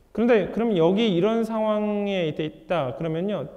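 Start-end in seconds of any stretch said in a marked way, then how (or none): none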